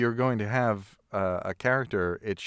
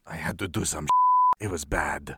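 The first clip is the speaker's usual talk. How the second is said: low, heavy, grim confession; a hint of a weary soldier; lightly voiced grim Cassian; mock grim soldier; 5 semitones lower than usual. Quoting Cassian's grim speech.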